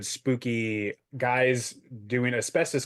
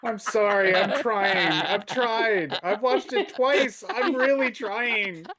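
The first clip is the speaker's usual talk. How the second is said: high-pitched, giggling through the words; can't stop laughing while apologising; laughing apology; 10 semitones higher, slower than usual. Real, not acted.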